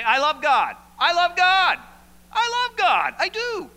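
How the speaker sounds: loud, high, building mock protest; mock insistent shout. Preacher mocking the brother-hater's protest, loud and high.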